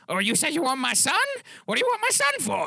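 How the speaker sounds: high-pitched, suspicious questioning; eccentric taxidermist father. Acted. Game master voices eccentric father.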